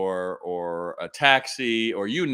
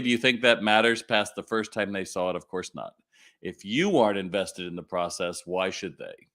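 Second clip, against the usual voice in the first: ordinary delivery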